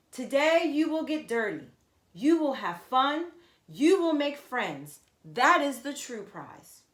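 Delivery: big event host voice; announcer. Acted. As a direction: announce it over the race speakers. MC announcing over speakers.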